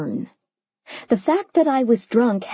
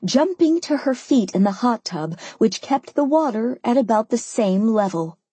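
usual speaking voice

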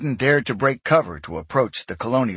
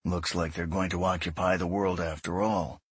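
flat; 3 semitones lower, 7 dB quieter than usual. Acted. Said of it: "Robert said flatly".

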